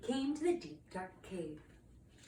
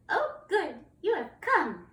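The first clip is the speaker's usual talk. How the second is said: high, louder dragon greeting; delighted young dragon; 8 semitones higher, 6 dB louder, slower than usual. Acted. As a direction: welcome the knight in an eager high voice. Third dragon greets the knight.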